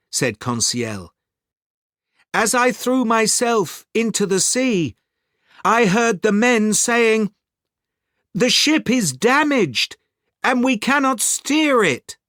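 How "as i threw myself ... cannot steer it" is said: higher-pitched servant's voice; loyal servant. Acted. Servant tells his master about the damaged ship, higher-voiced. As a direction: report the bad news in a raised character voice.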